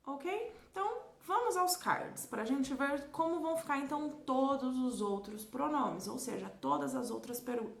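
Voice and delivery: ordinary delivery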